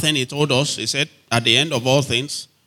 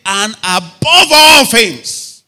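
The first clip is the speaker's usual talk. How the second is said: "above all things" drawn out slow, loud and high; emphatic scripture; 6 semitones higher, 7 dB louder, much slower than usual. Real, not acted. Preacher ranks love above prayer, "above all things" loud and drawn out. Stresses verse against preceding one.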